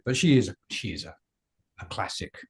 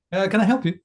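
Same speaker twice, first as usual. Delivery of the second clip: customer service voice; 9 semitones higher, 6 dB louder than usual. Shown as a demonstration. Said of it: "I probably can, actually".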